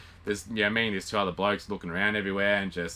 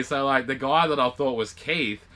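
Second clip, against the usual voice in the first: normal talking voice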